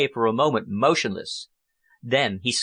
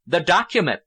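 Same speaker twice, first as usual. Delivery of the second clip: commanding order; 5 semitones higher, 4 dB louder than usual. Acted. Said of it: "the man ordered".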